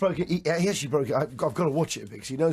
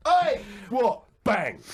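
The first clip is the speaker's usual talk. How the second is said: mock Cockney shout; 3 semitones higher, much slower than usual. Host retelling a pub fight, mock Cockney shout. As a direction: bark the Oi like a pub brawler. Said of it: "what he was meant to go".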